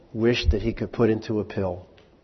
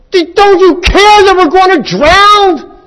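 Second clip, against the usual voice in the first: shrieking much higher and louder, slow and desperate; terrified disciples; 14 dB louder than usual. Acted. Disciples wake Jesus as the boat sinks, terrified shriek. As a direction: yell over the storm in terror. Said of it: "they wake him up and go".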